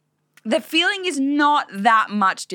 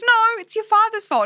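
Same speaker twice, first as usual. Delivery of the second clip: high, louder mock mum voice; mum deflecting; 13 semitones higher, 5 dB louder than usual. Quoting her mum blaming dad, high mock voice. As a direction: imitate mum shifting blame.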